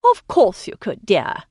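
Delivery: pat him on the head with words; a lightly voiced indulgent wife; comforting. A narrator's reading. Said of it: "the Baroness said comfortably".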